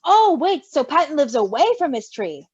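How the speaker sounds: ordinary delivery, nothing marked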